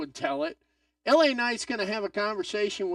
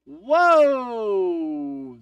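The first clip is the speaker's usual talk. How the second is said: amazed whoa; 8 dB louder than usual. Real, not acted. Reacting live to a big kick.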